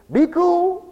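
high, loud mock "be cool"; worldly cool talk. Preacher mocking what the world says, high and loud. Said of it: "the world says".

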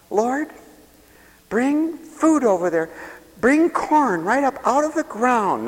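mock prayer. Mocking a faith-claimer's prayer.

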